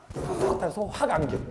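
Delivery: ordinary talk, nothing marked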